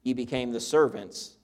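measured King James reading voice; preacher reading the Bible aloud to the congregation; scripture reading. Acted. Pastor reads Romans to his church, steady and measured.

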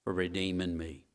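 a rising, repeated thank-you prayer; lightly preached prayer of thanks. Shown as a demonstration. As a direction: pray it with building praise. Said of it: "I don't think it hurt us every day to say".

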